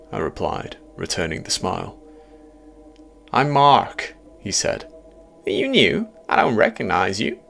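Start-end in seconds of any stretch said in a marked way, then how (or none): 3.3-4.2 high, loud, friendly American man; upbeat American newcomer-greeter; jovial American. A narrator's reading. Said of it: "A jovial American voice". 5.5-7.4 curious, high-pitched American; friendly American voice, louder and lifted; jovial American. A narrator's reading. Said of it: "A jovial American voice".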